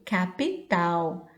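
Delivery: capital slowly, syllable by syllable; slow model word. Shown as a demonstration. Teacher giving pi in the middle, capital slowly.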